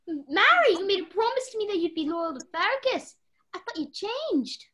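ordinary talk

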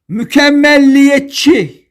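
Mükemmelliyetçi drawn out with long M and L; exaggerated double L. Shown as a demonstration. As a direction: stretch the doubled consonants in Mükemmelliyetçi. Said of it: "tek L ile yazılır".

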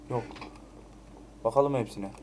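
usual speaking voice

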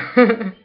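sudden loud laugh, high; loud burst of laughter. Real, not acted. Laughing loudly at a friend's reply on the phone.

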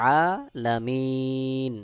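আলামিন very slow, with long aa and long ee; elongated vowels. Shown as a demonstration. Teacher models the word for learners, drawn-out আলামিন. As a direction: stretch the vowels of আলামিন in measured recitation. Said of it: "এক আলিফ টান দিয়ে".

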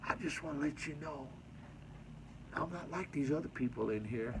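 softly voiced confession; retold hushed aside. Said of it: "he whispered in my ear".

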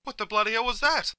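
high-pitched startled outburst. Acted. Driver jolted by something jumping out at the car, startled. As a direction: cry out in alarm at something sudden.

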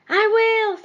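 high, loud promise; loudly. A narrator's reading. Jeremy answers the clock, high and loud. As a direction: shout it out firmly. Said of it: "said Jeremy loudly".

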